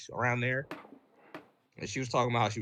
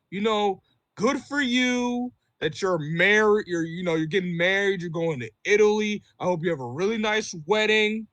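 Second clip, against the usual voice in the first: higher, louder, sneering put-on voice; mocking sarcastic customer; 6 semitones higher, 6 dB louder than usual. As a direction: ham up a bitter guest's fake congratulations. Customer's sarcastic rant at bartender.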